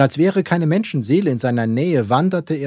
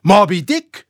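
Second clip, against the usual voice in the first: high, loud cry of recognition; startled captain's yell; shouted question; 9 semitones higher, 9 dB louder than usual. A narrator's reading. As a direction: shout the name, rising in disbelief. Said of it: "schrie Ahab".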